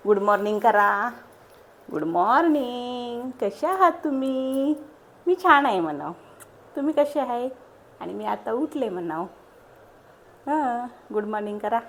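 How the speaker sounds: loud sing-song prompting. Real, not acted. Prompts baby to greet viewers.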